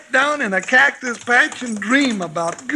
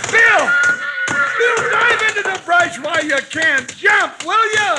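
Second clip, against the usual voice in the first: frantic warning shout; 8 semitones higher than usual. Acted. Sidekick yelling warning.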